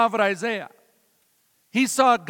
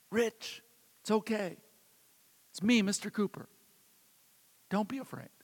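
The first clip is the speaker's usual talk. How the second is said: much softer, hushed reassurance; soft whisper; 9 dB quieter than usual. Retold or imitated. Mister Cooper reassuring the frightened boy on his lap, softly hushed. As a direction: murmur it so only the child hears. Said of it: "and he whispered, only loud enough for me to hear".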